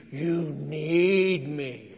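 slow, low, smug and self-important; conceited drawl. Pretend. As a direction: stretch it out like a conceited man. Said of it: "this Paul guy must have been conceited".

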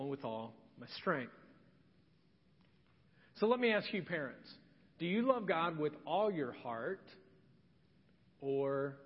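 ordinary talk

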